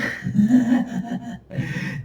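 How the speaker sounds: high gleeful villain cackle; mock evil laugh. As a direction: cackle like a demon.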